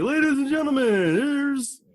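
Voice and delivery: high, slow showman intro; mock announcer. Host mocking formal intros with a showman voice. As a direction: stretch it out like a game-show host. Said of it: "I don't like doing, like, the whole".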